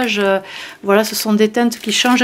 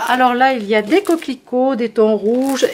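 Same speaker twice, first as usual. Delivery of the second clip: ordinary talk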